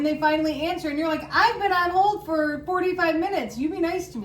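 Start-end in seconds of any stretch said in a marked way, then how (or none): 1.3-4.2 indignant. Retold or imitated. Retold snap at rude agent.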